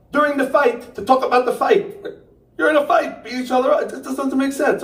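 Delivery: high-pitched comic bafflement; incredulous. Acted. Comic baffled by friends meeting mid-fight, incredulous.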